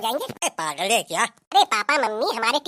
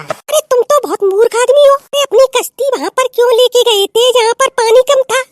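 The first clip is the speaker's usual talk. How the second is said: high, much louder scolding; 10 semitones higher, 10 dB louder than usual. Acted. Wife mocking husband's sunken boat line, loud scolding. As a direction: berate him at full voice.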